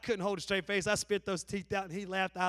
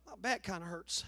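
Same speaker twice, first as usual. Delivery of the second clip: soft, pitiful complaining voice; feeble excuse; 5 semitones lower, 7 dB quieter than usual. Retold or imitated. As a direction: play the weakling making an excuse. Caller backing out of duty.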